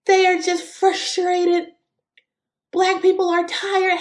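a hint of a condescending politician; mocking politicians. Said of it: "they love to say".

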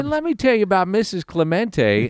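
high-pitched rambling storyteller impression; McCarver. Co-host imitating a broadcaster starting a Clemente story, hammed-up rambling. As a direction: pitch it up, launching into a mock anecdote.

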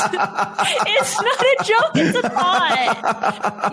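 giggling through the words; can't hold back a laugh. Real, not acted. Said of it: "I feel like you're laughing".

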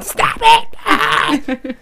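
put-on teasing his wife about losing it with the kids; mock yell. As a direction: yell it like a fed-up parent. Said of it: "you lose your shit".

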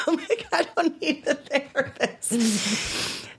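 slow, high words stretched by laughter; laughing. Real, not acted. Patient joking about the doctor's therapist advice, really laughing. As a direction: squeeze the line out through real laughing.

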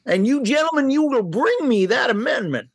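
creaky Western sidekick Lincoln; old prospector. Retold or imitated. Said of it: "He's doing old Prospector Joe".

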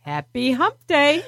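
high, drawn-out cheery sing-out; excited greeting. Real, not acted. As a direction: call it out long and high with a laugh close behind. Host opens show with catchphrase greeting.

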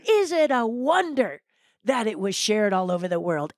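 normal talking voice

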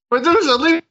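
high-pitched comic yell; mock yell. Addict hallucinating a Medusa and a leprechaun, high mock yell. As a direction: call it out in a high, silly voice. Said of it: "he yelled that out".

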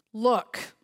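mild mock disrespect; disrespectful. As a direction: throw it at your father like an insult. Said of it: "He addresses the father very disrespectfully".

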